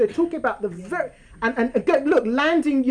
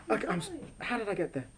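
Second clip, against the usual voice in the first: normal talking voice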